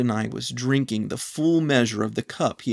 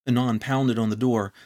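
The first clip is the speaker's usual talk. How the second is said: ordinary delivery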